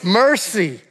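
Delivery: loud, high-pitched pleading cry to God; rising preacher's shout of petition; shouted prayer. Acted. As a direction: call out the prayer with full force. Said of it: "Shout it out".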